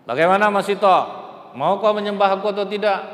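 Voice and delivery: raging king commanding Haman; furious Pharaoh. Acted. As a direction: bellow the orders to boil them. Pharaoh's final demand.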